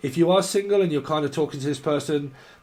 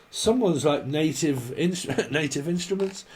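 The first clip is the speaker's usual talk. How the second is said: normal talking voice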